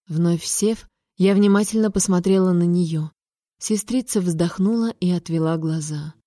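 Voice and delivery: ordinary delivery, nothing marked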